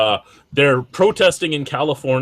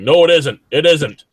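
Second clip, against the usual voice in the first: higher, louder mimicked protest; mock insistence; 4 semitones higher, 6 dB louder than usual. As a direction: imitate a stubborn drinker objecting. Mimicking a stubborn one-brand beer drinker.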